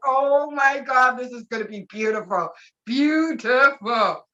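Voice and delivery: drawn-out gushing, last word greatly stretched; awed anticipation. Retold or imitated. Fan imagining everything lighting up, slow awed gushing. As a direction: savour it slowly in delighted wonder. Retold awe at the coming launch.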